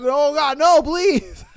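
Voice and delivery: hammed-up desperate begging; mock pleading. Host playing the caught boyfriend, pleading high and loud. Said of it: "And you're like".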